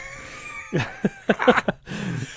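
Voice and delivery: very high squealing laugh; real giggle fit; helpless laughter. Real, not acted. Laughing at the accent joke.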